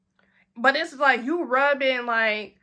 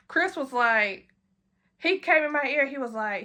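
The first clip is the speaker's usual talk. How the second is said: normal talking voice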